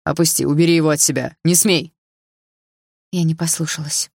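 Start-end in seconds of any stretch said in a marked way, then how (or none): 0.0-1.9 alarmed shouting. A narrator's reading. Dima shouting at her.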